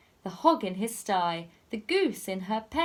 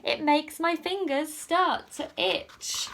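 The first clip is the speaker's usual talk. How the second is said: gleeful thief; 9 semitones higher than usual. Acted. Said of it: "And then Len replied".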